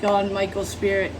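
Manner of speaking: usual speaking voice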